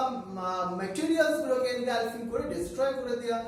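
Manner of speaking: normal talking voice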